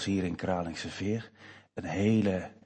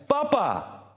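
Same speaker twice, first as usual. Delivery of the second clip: sister's answer. Retold or imitated. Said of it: "Toen zei ze".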